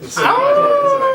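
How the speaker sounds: very high squeaky guinea pig. Acted. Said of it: "terrible guinea pig impression".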